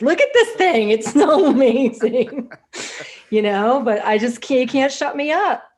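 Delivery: high, loud burst of excitement with laughter; excited gushing. Real, not acted. Funnel builder fan raving about it to viewers, high-energy gushing. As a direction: gush loudly, pitch soaring, laughing as you go. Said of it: "hear [name]'s energy".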